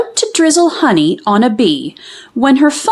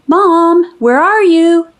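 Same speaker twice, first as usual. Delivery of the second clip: high, louder call through the house; yelling for Mom; 7 semitones higher, 4 dB louder, slower than usual. A narrator's reading. Girl home from school calls for her mom. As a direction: shout it from the front door. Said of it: "Amelia Bedelia yelled".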